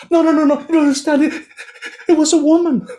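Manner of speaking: can't get the words out; panicked stammer. Acted. Terrified merchant stammers to the soba seller, high panicked.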